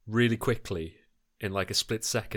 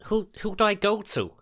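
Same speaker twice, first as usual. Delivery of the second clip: high, loud, stammered question; flustered panic; 12 semitones higher, 5 dB louder than usual. Acted. Jasper asks Gethin where to run, flustered and panicky. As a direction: blurt it back in a panic. Jasper answers shouted order mid-fight.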